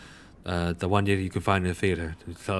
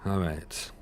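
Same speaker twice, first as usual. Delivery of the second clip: ordinary talk, nothing marked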